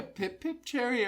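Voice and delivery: hammed-up British attempt; cartoonishly British, with a cracking voice; British accent. Shown as a demonstration. Podcaster explaining the intended British accent. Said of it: "I was going for more like".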